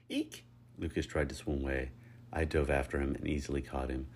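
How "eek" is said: very high little squeak; mock-frightened eek; squeal. A narrator's reading. Lucas trying to swim away, squealing. Squeal while fleeing in the pool.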